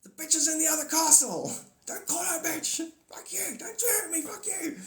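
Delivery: very high cartoon squawking; hammed-up high bickering voices; Bowser and Mario. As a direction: squeal out a game-character spat. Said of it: "That's Bowser and Mario".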